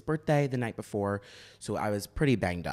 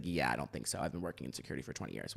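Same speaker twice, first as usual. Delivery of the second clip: dismissive bouncer; 7 semitones lower, 7 dB quieter, faster than usual. Retold or imitated. Bouncer dismissing her threat.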